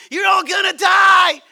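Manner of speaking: high, loud, drawn-out shout; mock doomsday yell. Activist yelling out at a conference, mock doom shout. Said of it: "yell out and say".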